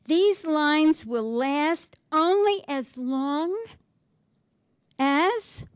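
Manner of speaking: slow, lifted verse reading; poem recital. Acted. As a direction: read the line as if it will outlast you. Reading sonnet aloud.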